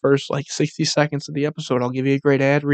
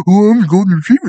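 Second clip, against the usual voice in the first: higher, louder, fast silly voice for the dog; mock dog voice; 6 semitones higher, 6 dB louder, faster than usual. Mocking the dog video, silly dog voice. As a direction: ham up the dog talking. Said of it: "He's like".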